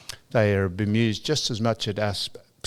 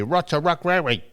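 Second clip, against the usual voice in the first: higher-pitched imitated Japanese accent, every L turned to R; mock Japanese accent; 5 semitones higher than usual. He imitates Japanese listeners to wartime code, mock Japanese accent. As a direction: say "Lots of luck, Larry" as "Rots a ruck, Rarry". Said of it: "they can't say".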